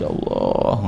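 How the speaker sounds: low, drawn-out mock tearful plea; menirukan tangisan doa. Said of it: "Ya kan, nangis". (pura-pura menangis memohon ampun)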